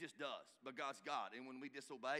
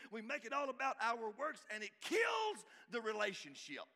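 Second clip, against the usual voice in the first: louder, higher preacher's drive; full-force preaching; 7 semitones higher, 5 dB louder than usual. Acted. Pastor warning couples about performance, preaching hard. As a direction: hammer the point home, building.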